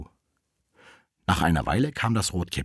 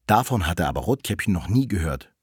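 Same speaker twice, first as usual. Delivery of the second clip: ordinary delivery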